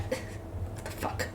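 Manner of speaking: real whispered swear to yourself; barely audible, drawn-out whisper of disbelief; baffled whisper. Real, not acted. Reader baffled, mutters to self.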